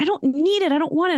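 high-pitched mock refusal; daughter's retold refusal. Daughter refusing packed lunch, retold.

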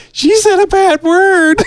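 high, slow Elmo-style voice; squeaky kid puppet voice. Retold or imitated. Retelling Elmo's line.

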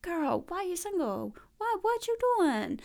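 high, teasing uncle voice; a hammed-up uncle grilling his niece; nosy uncle. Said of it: "Uncle Graham is like".

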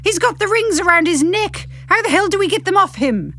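high, frantic questions; panicked high voice. Acted. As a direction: ask it in a panic. Panicked ghost voice.

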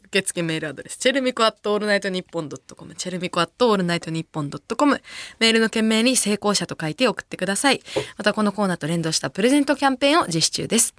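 brisk, even announcer's read; radio announcement read. Real, not acted. Host gives listeners the mail address and campaign, radio announcement read. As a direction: read out the show notice smoothly.

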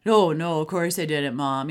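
mock bratty kid, loud first "No"; back-talking kid. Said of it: "they just give you that, like".

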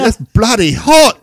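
loud, high, drawn-out mock Aussie; botched Australian accent, nearer Cockney. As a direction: overdo the Aussie until it slips into Cockney. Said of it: "that's more Cockney".

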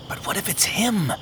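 words catching in throat. Acted. Faintheart fears Great Grace is coming, soft and frightened. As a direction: barely get it out for fear. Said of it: "the words catching in his throat".

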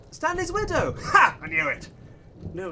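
ordinary talk

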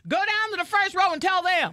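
high-pitched mock complaint; arguing fan. Joins in the acted fan quarrel.

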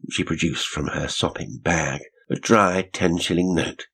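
plain reading voice

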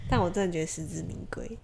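quiet whispered aside. Real, not acted. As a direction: whisper it sincerely. (小声说)

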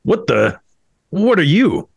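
high, loud startled cry; shocked question to a creature. Acted. Rudy faces talking croissant.

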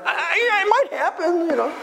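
high-pitched acted maybe; tentative hope. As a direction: concede it in a very high voice. Answering the hopeless loser with faint hope.

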